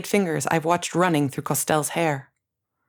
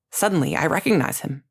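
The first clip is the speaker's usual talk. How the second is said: ordinary delivery, nothing marked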